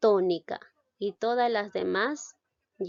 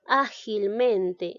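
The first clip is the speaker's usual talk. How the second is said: "Ágilmente" slowed, stress on Á and on MEN; drawn-out Á-gil-MEN-te; double stress; much slower than usual. Shown as a demonstration. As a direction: sound out Ágilmente with two strong beats. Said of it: "recae en A".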